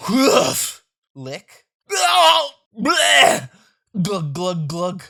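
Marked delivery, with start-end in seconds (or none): 0.1-0.8 loud high bark; hammed-up dog bark; barked woof. 1.9-3.5 loud high gagging blurt; hammed-up disgust. Disgusted outburst.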